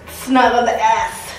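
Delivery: slow words through a laugh. Real, not acted. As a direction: giggle while you say it. Laughing about tight fit.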